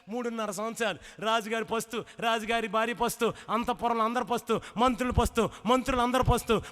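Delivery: loud, driving sermon build to a roar; preaching at full force. Acted. Preacher whipping up the congregation about the widow's two factories, full-force preaching. Rousing sermon climax, calls applause.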